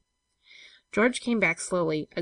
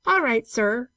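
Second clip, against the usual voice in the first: higher, louder cheerful call; raised, upbeat shout; cheerful cry; 6 semitones higher, 6 dB louder, slower than usual. A narrator's reading. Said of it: "cried George cheerfully".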